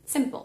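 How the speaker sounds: "Simple" con una O suave entre la P y la L; "Simple" con un sonido accesorio suavecito; soft o before L. Shown as a demonstration. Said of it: "tranqui, suave". ("Simple" terminando en una O suave)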